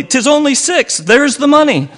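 high, loud and insistent; Billy pressing his money on the auctioneer; insistent cry. A narrator's reading. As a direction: insist on the first bid. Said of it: "cried Billy".

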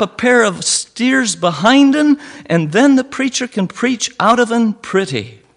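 high, loud, eager Cornish dialect; Billy bursting with glee over the cupboard; excited Cornish cry. A narrator's reading. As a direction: plan it out in a rush of joy. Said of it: "cried Billy".

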